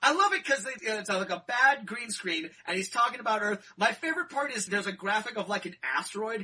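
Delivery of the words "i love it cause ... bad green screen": normal talking voice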